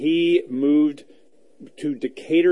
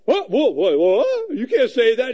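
mock shocked agent; 9 semitones higher, 4 dB louder than usual. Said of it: "they go".

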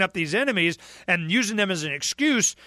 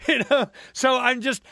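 ordinary talk, nothing marked

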